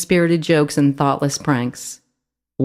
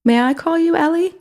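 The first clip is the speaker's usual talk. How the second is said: hopeful; 12 semitones higher than usual. A narrator's reading. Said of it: "Timothy asked with hope in his voice".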